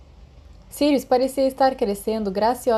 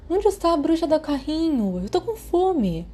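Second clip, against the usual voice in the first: put-on whiny hungry boy; 9 semitones higher than usual. A narrator's reading. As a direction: drag it out like a hungry kid begging. Peter whines for the sweets trolley.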